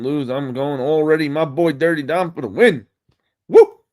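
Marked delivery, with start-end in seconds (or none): 3.5-3.8 a high, loud whooping cheer; excited cheer. Real, not acted. To the stream, cheering Dirty Dom, high loud woo. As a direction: let out a big wrestling-fan woo. Cheering after naming his pick.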